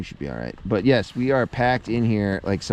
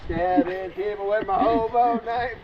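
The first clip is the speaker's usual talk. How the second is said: hobo voice; 8 semitones higher than usual. Pretend. Joking hobo character.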